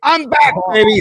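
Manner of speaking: high, loud shout of I'm back; excited return. Real, not acted. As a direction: burst back in with a triumphant call. Host returning to stream, calling out.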